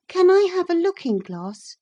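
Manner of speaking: put-on high, eager girl's voice; princess bargaining. A narrator's reading. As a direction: offer the money brightly.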